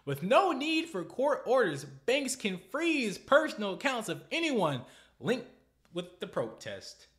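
high, loud and slow in disbelief; shouted reread at high pitch; outraged shout. Real, not acted. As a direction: belt it out in outrage. Reads outrage again, shouting.

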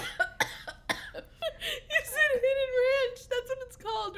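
high, slow, laughing through tears; crying with laughter. Real, not acted. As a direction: barely get it out for laughing. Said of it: "I'm crying".

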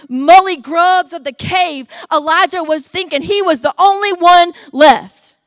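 loud, high, fired-up sermon; roaring Sunday preacher climax; full-force preaching. Acted. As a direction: preach it to the back row.

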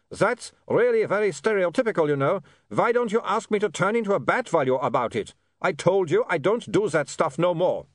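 put-on high, indignant vampire accent; affronted vampire Otto. Otto objects to the bat cliché, affronted vampire.

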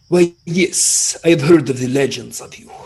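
lightly put-on Elven accent; a hint of a lilting Elven accent; player's elf greeting the brownie, light Elven accent. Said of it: "Is that an Elven accent".

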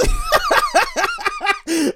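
high laugh cutting off the sentence; real, helpless cackle; bursting into laughter. Real, not acted. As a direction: break up laughing mid-thought. Cracks up mid-sentence.

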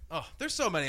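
ordinary talk

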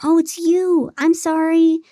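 high-pitched exasperated groan. A narrator's reading. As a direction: groan an apology, put upon. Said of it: "she groaned".

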